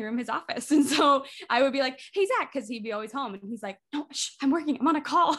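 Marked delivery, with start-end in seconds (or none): 2.1-2.5 high, loud, bright call to a husband. Retold or imitated. Wife greeting husband who works from home, calling out. 3.9-5.3 imitated shushing. Husband hushing her, retold with laughs.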